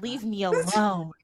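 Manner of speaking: ordinary delivery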